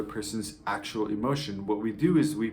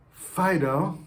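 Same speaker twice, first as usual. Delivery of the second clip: Fido called higher and louder; scolding call; 7 semitones higher, 4 dB louder than usual. Shown as a demonstration. Owner scolding the dog Fido, loudly. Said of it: "saying your child's name, or you say your dog's name".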